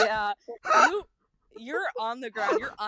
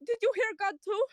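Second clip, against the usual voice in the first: startled farmer; 9 semitones higher than usual. Acted. Startled farmer asks Rain about God's voice.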